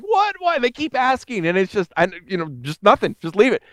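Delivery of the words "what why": put-on high, exasperated teen whine; frustrated teens. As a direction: protest like a kid denied candy.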